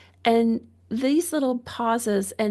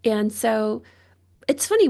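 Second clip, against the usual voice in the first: ordinary delivery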